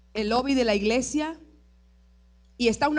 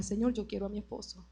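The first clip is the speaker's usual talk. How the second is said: soft, quick private plea; hushed, mildly pleading retelling; retold tearful prayer; 2 semitones lower, 12 dB quieter, faster than usual. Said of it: "llorando, le decía".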